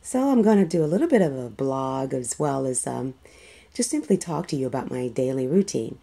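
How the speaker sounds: groggy just-woke-up voice; sleepy voice. Real, not acted. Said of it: "It's my sleepy voice today".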